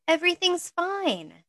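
exclaimed high and tight; voice rising. A narrator's reading. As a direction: snap it out with a pitch jump. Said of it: "Pansy exclaimed, her voice rising".

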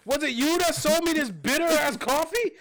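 high, loud mock accusation; mock accusing. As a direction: call her out like a playful threat. Mock confrontation with the cashier.

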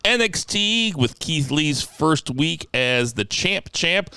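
punchy podcast announcer read; show announcer. Acted. Host announces tonight's podcast topics to listeners, announcer style. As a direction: hype up tonight's line-up. Reads the show's opening rundown.

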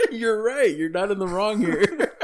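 high, loud laughing agreement. Real, not acted. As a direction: laugh through it, slowly.

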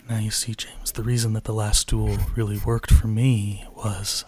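low, slow and close on the mic; playful close-mic ASMR read for the listeners; mock ASMR voice. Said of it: "Can you get in really close, like a little A S M R moment?".

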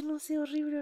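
ordinary talk

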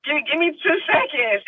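high, whimpering mock begging; mock tearful plea. Tearful actress to the crew before next scene, mock tearful plea. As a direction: beg for a moment, mock weepy.

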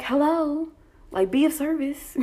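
high-pitched, impatient hello; retold indignant summons. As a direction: call out for overdue help. Summons an onlooker to help.

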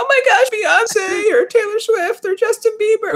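very high, slow, squealing fan; high-pitched excited fan squeal; starstruck fan squeal. Retold or imitated. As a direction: shriek like a fan meeting their idol. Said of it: "they're freaking out".

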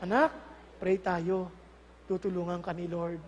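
put-on low, hushed comfort for a child; gently calm the child, very softly; soothing parent. (malumanay na magulang)

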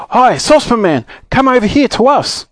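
high, carrying call. A narrator's reading. Friends to the saucepan man downhill, calling out. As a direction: hail someone from a distance.

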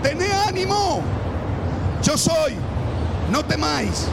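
high, loud, commanding call; Jesus calling out. Acted. Jesus calls to frightened disciples, loud and steady. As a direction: call across the waves to calm them.

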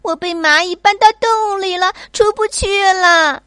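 high, loud call from the cave; yelling for rescue; shouting. Acted. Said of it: "小希大喊". (小希在洞里大声求救)

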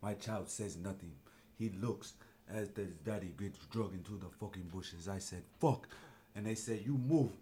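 low, dark mock African accent for a captured tribesman; lightly put-on African accent telling of capture. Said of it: "you can do an accent".

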